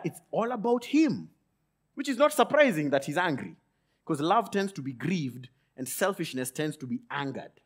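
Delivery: ordinary talk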